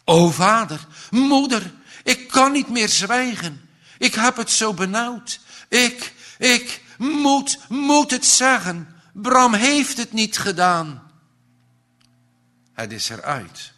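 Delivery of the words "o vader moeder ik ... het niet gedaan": high, loud, distressed confession; a sick boy desperately calling to his parents; anguished cry. A narrator's reading. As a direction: blurt it out in anguish, voice raised and high. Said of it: "en roept".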